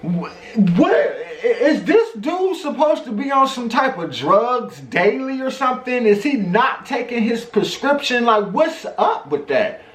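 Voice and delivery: a shouted what with a laugh, then high disbelief; stunned disbelief. Retold or imitated. Reacting to the coworker's threat with a laughing, high what. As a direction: laugh at how absurd it is. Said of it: "I'm sitting here like".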